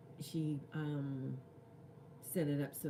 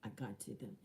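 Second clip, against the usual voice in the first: ordinary delivery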